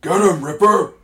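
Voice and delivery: high, very loud brute shout; bugbear shout. A narrator's reading. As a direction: bellow the command at full force. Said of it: "shouted the bugbear".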